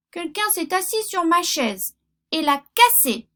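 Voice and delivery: little bear dismayed his chair is broken; high squeaky baby-bear cry; tiny bear voice. Acted. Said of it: "dit-il de sa toute petite voix".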